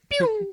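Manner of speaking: very high zipping whistle noise; cartoon slip sound. Slippery kid wiggles away, cartoon sound effect. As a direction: imitate a cartoon banana slip. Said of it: "the banana sound you hear in the cartoons".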